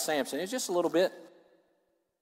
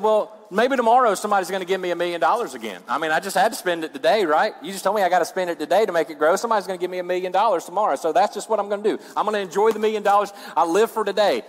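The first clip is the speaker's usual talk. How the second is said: high, fast, carefree rush; acted heedless spender; 6 semitones higher, 4 dB louder, faster than usual. Preacher playing someone squandering a million dollars, fast and heedless. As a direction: rattle off excuses in a raised voice.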